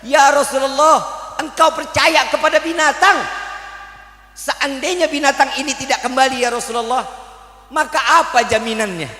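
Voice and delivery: indignant shout. Acted. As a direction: shout your doubt at him. Hunter challenges the Prophet.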